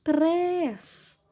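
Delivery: "Três" held long and high; stressed syllable demo. Shown as a demonstration. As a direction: draw out the vowel of "Três". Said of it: "Sílaba mais forte é o três".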